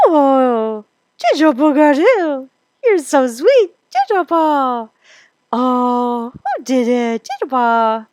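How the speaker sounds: high, loud, exaggerated happy babbling; baby talk. Shown as a demonstration. Instructor showing how an adult babbles to a baby, gushing baby talk. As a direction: gush and babble in made-up baby words. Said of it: "give you an example of baby talk".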